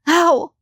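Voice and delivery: sharp high yelp of pain; pained exclamation. A narrator's reading. Mother kicked in the shins, yelping in pain. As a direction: cry out as the kick lands. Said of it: "Sharon exclaimed".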